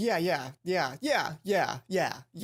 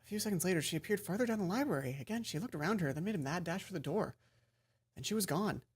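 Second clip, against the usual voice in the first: ordinary talk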